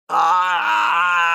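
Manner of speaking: high cry of burning pain; pained scream. Acted. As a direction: let out a sharp shriek. Quirrell burned by Harry's touch.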